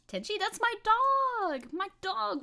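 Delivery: high, slow, gushing delight; excited about dog. Real, not acted. Streamer introduces her dog to chat, high gushing delight. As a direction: squeal the dog's name with joy.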